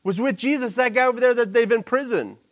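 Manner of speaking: pointing out Peter. Retold or imitated. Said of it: "he's saying".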